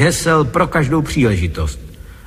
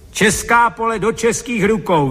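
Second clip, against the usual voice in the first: cried-out slogan; 7 semitones higher than usual. A narrator's reading. Son crying a slogan before the works council chairman, mild high-pitched cry. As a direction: cry the slogan at a raised pitch, without volume. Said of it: "vykřikl jsem".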